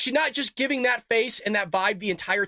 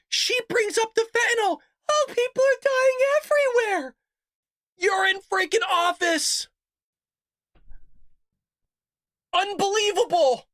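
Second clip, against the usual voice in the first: exasperated outrage; 11 semitones higher than usual. Real, not acted. Ranting at debate moderators.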